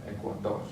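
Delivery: "Ecuador" stressed on final dor; stress on last syllable. Shown as a demonstration. Teacher shows the rule for R endings, "Ecuador" stressed on dor. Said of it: "stress the last syllable".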